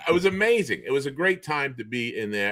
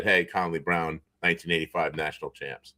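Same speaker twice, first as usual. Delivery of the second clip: softer, lower mock replay of the proud intro; dry, lowered imitation poking fun at the buddy; mock introduction; 5 semitones lower, 4 dB quieter than usual. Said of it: "just said".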